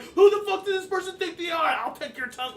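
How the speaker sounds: fast, high, shouted threat; crowd freaking out. Acted. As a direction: yell over the crowd in outrage. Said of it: "start to freak out".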